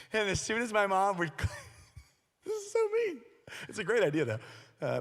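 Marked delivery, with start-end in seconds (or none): 1.3-3.2 laughing. Real, not acted. Laughs confessing a prank.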